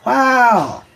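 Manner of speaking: loud excited wow; excited shout. Real, not acted. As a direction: shout it out, amazed. Reacting to high praise.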